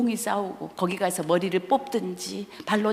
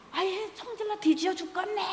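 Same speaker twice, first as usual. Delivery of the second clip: mock exasperated outburst; 10 semitones higher, 6 dB quieter than usual. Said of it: "나도 그러면서".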